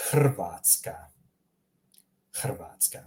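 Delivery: "Hrvatska" with throaty h and rolled r. Shown as a demonstration. Teacher models for learners, "Hrvatska" with rolled r. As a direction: roll the r in "Hrvatska". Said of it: "remember to roll the R's".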